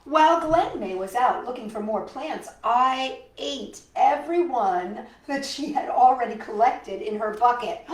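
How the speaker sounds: put-on lively storybook narration for kids; reading to children. A narrator's reading. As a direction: read it like a picture book to little ones. Reads picture book aloud.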